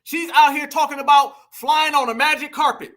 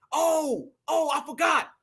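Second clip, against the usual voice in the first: ordinary talk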